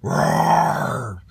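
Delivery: loud roar in a dino voice; dinosaur roar. A narrator's reading. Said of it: "let out a mighty roar".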